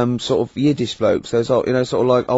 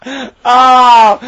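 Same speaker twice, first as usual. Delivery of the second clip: loud, high cackling howl; shrieking laughter; 12 semitones higher, 8 dB louder than usual. Real, not acted. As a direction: burst into uncontrollable laughter, ending in a long "ah". Carl's crab answer cracks him up.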